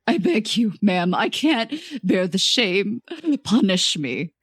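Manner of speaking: slow, drawn-out acted plea; pleading. A narrator's reading. Ashamed manager begs customer to punish him, pleading. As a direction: beg slowly, weighed down by shame.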